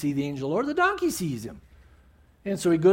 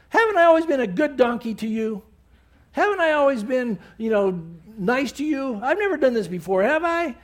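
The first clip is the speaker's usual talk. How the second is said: higher, louder, fast hurt donkey voice; talking donkey; 7 semitones higher, 4 dB louder than usual. Retold or imitated. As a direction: plead as the wronged donkey. Donkey speaking to Balaam.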